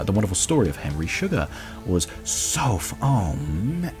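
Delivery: usual speaking voice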